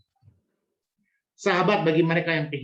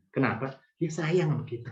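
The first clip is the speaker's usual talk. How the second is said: ordinary delivery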